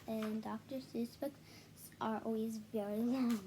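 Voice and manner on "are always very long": usual speaking voice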